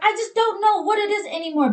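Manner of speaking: high, pleading mock-teary voice; mock teary plea. Wife losing a fight to her husband, high mock-teary plea. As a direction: play yourself at your wits' end, close to tears. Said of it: "I was like".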